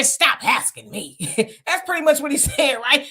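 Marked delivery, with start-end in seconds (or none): 0.0-1.2 slow, sassy mock rebuke; haughty mimicry. Host mocking a politician who brushes off questions, haughty. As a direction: imitate someone too haughty to be questioned. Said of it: "they get so haughty and say".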